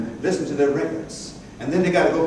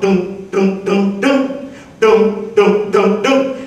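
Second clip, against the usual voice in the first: piano imitation; much slower than usual.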